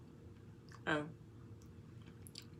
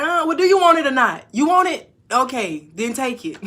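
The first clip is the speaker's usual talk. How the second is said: impatient salesperson; 7 semitones higher, 9 dB louder than usual. Retold or imitated. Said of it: "when they be like".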